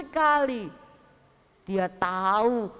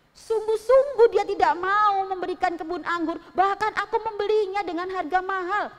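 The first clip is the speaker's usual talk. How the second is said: sulky king; 8 semitones higher, 4 dB louder than usual. Acted. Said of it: "kata si Raja Ahab".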